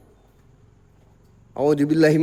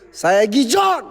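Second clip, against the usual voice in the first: defiant shout; 4 semitones higher, slower than usual. Real, not acted. Challenging the hotel spirits.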